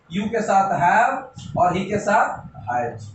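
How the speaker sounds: slow dictation. Shown as a demonstration. Teacher dictating the rule.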